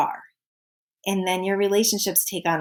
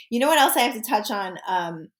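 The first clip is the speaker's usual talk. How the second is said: ordinary delivery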